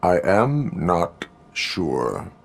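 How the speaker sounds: very slow, measured reply; slow, halting, deliberate answer; hesitant cyborg. Acted. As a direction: draw it out word by word with pauses. Cyborg hesitates over psychologist's offer.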